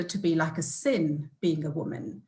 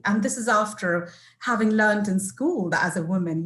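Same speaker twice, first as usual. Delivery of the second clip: normal talking voice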